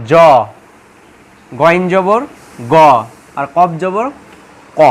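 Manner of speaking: "খ", "র", "ত", "জ", "গ", "ক" each ending in a round "aw"; pause, then sound "খ", "র", "ত" and the rest with aw; rounded o vowel. Shown as a demonstration. Teacher listing the six exceptions, "খ" to "ক" with rounded aw. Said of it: "অর মতো উচ্চারণ হবে".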